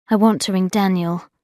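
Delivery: ordinary delivery, nothing marked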